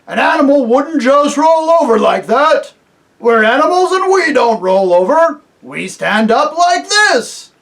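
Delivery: proud bear demonstrating. A narrator's reading. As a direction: bear voice rising to a show. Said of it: "he rose to his hind legs".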